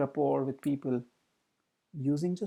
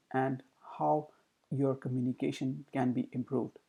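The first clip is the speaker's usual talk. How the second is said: flat, lower, softer computer-like read; monotone demo; 4 semitones lower, 5 dB quieter than usual. Shown as a demonstration. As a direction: drone on without tune. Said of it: "An example of monotone could be".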